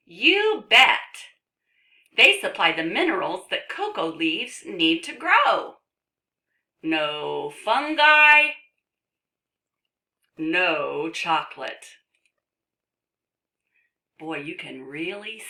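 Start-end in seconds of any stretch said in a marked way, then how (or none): 0.1-11.8 loud, emphatic storybook delivery; reading to children. Acted. Reads book to children.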